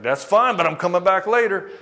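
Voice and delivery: husband shrugging off his wife's rejection, loud and breezy; bright carefree retort. Retold or imitated. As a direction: toss it back to her, light and loud. Carefree husband answering wife, acted quote.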